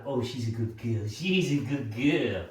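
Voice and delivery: low, slow, doting praise; fussing praise. Shown as a demonstration. Owner fussing over the dog after a good recall, low slow coo. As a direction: coo over the dog like she's done something wonderful. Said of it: "lots of fuss".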